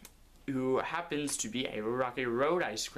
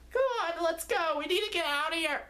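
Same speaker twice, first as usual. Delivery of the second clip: high squeaky voice, louder and fast; urgent little cone; 4 dB louder than usual. Acted. Lenny urging Barrick to flee, fast high squeak. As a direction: hurry him along, cartoon squeak. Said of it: "Lenny said".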